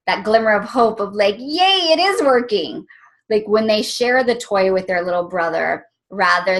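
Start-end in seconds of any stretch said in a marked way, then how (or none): 1.4-2.8 excited cheer. Retold or imitated. Glimmer of hope, cheering.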